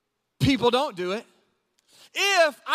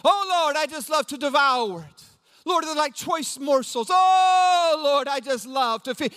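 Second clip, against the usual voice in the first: hammed-up devotional rapture; 3 semitones higher, slower than usual. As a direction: swoon over every phrase, overdoing the adoration. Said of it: "start your day reading the Bible like that".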